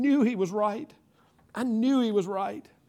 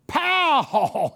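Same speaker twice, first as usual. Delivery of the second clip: loud, higher "pow"; imitated balloon pop; 7 semitones higher, 6 dB louder than usual. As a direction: burst out with a sudden popping noise.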